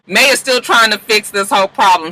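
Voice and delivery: usual speaking voice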